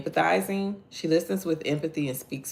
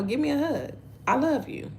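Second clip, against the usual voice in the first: high loving greeting; 12 semitones higher than usual. Retold or imitated. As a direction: voice jumps high for a mother's hug request. Mother greeting son warmly.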